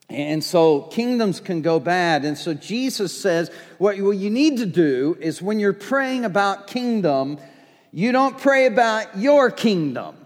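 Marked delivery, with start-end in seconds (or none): none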